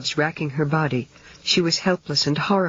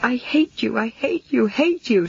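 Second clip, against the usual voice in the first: sobbing; 8 semitones higher than usual. A narrator's reading. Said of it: "she sobbed".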